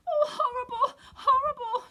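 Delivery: very high, loud sobbing cry; Dorothy sobbing into the dog's neck. A narrator's reading. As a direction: sob the words out. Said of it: "she cried".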